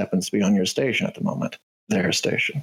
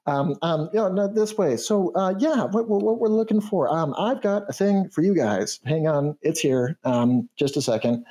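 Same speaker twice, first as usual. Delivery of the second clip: canary voice; 8 semitones higher than usual. Acted. Canary NPC leading players.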